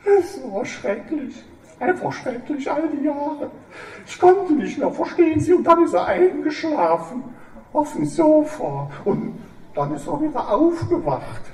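high, tearful and pleading. Acted. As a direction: confess it through tears, begging. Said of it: "Frau Kamps weinte".

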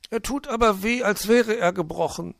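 hohes Gejammer eines Vögelchens; jammernd. A narrator's reading. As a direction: jammere hoch über den Schmerz. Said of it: "jammerte Zwitschi". (wehleidig jammern)

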